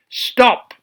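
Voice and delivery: sharp, high command; officer's shout. Acted. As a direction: halt the queen at the gate. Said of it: "shouted the commanding officer".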